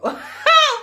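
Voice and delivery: real laughing fit; loud high laugh. Real, not acted. Big laugh at her own story.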